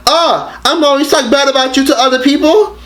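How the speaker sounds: high, hammed-up indignant mom voice; mock-offended mom. Mom acting shocked on the phone, mock-offended and high. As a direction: play her pretending to be shocked. Mother voiced in retold call.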